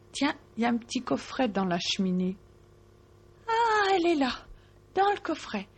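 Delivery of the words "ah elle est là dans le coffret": high and delighted; delighted discovery. A narrator's reading. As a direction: cry out in joy at finding it. She finds the hazelnut.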